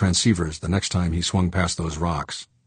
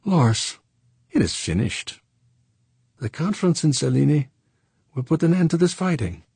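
higher French voice, calmer and reasoning; reasonable Frenchman; 9 semitones higher than usual. Acted. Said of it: "Saint Clair said more reasonably".

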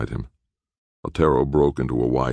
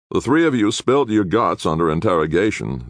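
mildly accusing, raised pitch; almost accusing; 7 semitones higher, 4 dB louder than usual. A narrator's reading. Attorney to three jailed clients, almost accusingly. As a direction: put a touch of blame on it. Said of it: "almost accusingly".

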